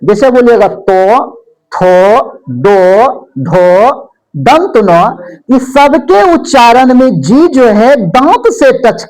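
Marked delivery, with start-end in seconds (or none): 0.7-4.9 very slow, lower, each sound isolated; slow articulation demo. Shown as a demonstration. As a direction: say थो, दो, धो one by one, tongue on the teeth. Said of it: "अब इस वर्ण को आप बोल के चेक कीजिए".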